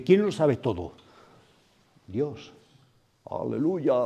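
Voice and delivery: ordinary talk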